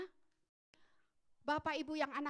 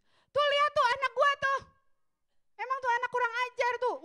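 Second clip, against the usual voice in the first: put-on loud, high, scornful scolding of a child; a mother snapping at her child in church, loud and high; public scolding; 9 semitones higher, 10 dB louder than usual. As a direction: shame the kid in front of everyone.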